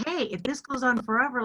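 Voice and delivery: ordinary talk, nothing marked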